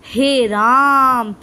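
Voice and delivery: हे राम with the आ of राम drawn out very long; prolonged pluta vowel. Shown as a demonstration. Teacher showing a long pluta vowel in हे राम. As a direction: stretch the आ in राम for three beats. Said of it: "तो आप देखो, खुद देखो".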